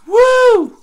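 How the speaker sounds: very high, very loud whoop of joy; cheer. Acted. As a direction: let out a huge delighted whoop. Bluey cheers.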